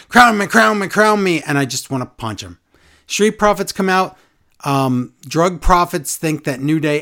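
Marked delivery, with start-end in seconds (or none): 0.1-1.4 high, louder whiny chant mocking him; sing-song the demand like a nagging kid; mocking impression of a wrestler begging for the crown; mocking Woods. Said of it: "Woods is going".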